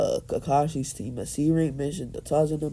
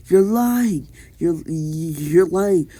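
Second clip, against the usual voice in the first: raised, louder cry of disbelief; mock scream; 3 semitones higher, 5 dB louder, slower than usual. Sakura accusing Hiruzen of lying, mock scream. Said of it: "Sakura screams".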